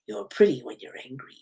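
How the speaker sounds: hushed, creepy taunt; Ghostface. Acted. Killer teases angry Gail on the phone, hushed and creepy. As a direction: keep it very soft, needling her. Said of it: "getting a call from Ghostface".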